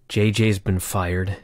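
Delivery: low, slow, downcast voice; lamenting announcer. Retold or imitated. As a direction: mope it out with head hung low. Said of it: "with his head hung low".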